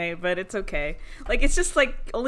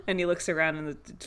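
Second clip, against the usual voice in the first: normal talking voice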